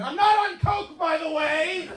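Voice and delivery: high-pitched, loud protest; yelling denial. Acted. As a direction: yell it like a frantic denial. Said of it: "more than yelling".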